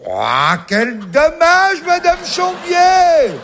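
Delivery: milk the fake pity of a radio host; hammy host. Radio host mocking the caller's loss, loud, drawn out. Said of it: "Je vais vous la faire".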